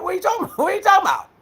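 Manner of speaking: high-pitched mocking challenge; mock bravado. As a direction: parody a man puffing himself up for a fight. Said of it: "acting this all bravado".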